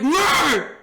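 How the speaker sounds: put-on high, loud drunken holler; imitated hammered shout. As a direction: call out like a wasted buddy imitation. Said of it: "he's fucking hammered at this point".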